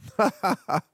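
amused, uncontained chuckle; short burst of real laughter. Real, not acted. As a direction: laugh helplessly at the insanity. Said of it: "Sorry, I can't stop laughing sometimes".

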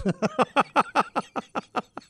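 high, light chuckle; a run of quick, high giggles; laughing. Real, not acted. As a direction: laugh in amazement at the odd word. Amused by the odd definition.

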